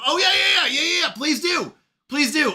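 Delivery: high, excited rush of yeses; eager agreement. Real, not acted. Streamer urging a viewer to share good news, eager agreement. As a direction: urge them on with real eagerness. Eagerly welcomes a praise report.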